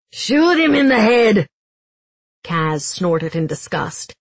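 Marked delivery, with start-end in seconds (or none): 0.1-1.5 high, loud panicked order; desperate bellow. A narrator's reading. Giels ordering Holst to shoot Kaz, desperate shout. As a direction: yell it up to the gunman. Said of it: "desperation sawing at his voice".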